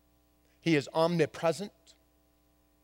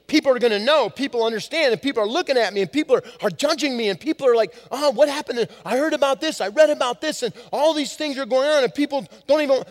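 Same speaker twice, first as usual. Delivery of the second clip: higher, louder, rushing inner worry; anxious inner voice; 7 semitones higher, 5 dB louder, faster than usual. Retold or imitated. Ashamed sinner imagines church people judging, anxious rush. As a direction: spiral into fear of gossip. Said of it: "we're thinking".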